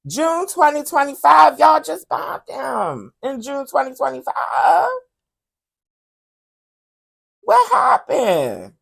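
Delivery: high, drawn-out disbelief; incredulous outburst. Real, not acted. Vlogger stunned the site was bombed again, high and incredulous. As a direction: stretch it out in disbelief. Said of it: "incredulous outburst".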